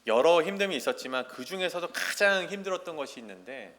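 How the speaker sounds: usual speaking voice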